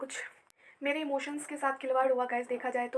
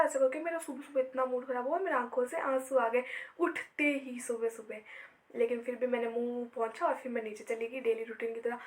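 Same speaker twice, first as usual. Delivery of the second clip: normal talking voice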